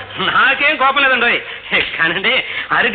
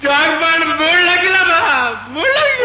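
wailing; 7 semitones higher than usual. Acted. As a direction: blubber it out like a broken man. Man wailing over lost jackpot.

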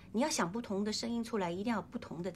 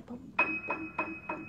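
bell-like plinks; 4 semitones higher, much faster than usual. Shown as a demonstration. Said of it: "清脆的小铃铛声音".

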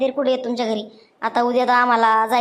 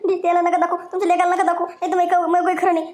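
higher, frantic begging; desperate pleading; 6 semitones higher than usual. Acted. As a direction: beg him, terrified of being exposed. Begging him to delete video.

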